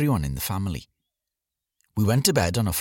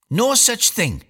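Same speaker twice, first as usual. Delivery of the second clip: put-on high, louder snap of a nine-year-old girl; snapping girl; 13 semitones higher, 4 dB louder, slower than usual. A narrator's reading. Eldest daughter Eileen snaps at her little brother, high and sharp. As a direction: cut him off like a know-it-all big sister. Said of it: "snapped Eileen".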